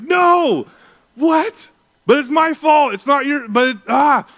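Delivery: high, loud frantic cry; panicked outburst. Retold or imitated. Father panics on learning his son walked off, loud frantic cry. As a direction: blurt it in panic over the lost son.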